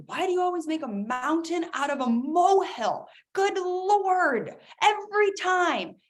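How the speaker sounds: high, exasperated sighing complaint. Retold or imitated. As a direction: roll your eyes at the drama. Spouse exasperated.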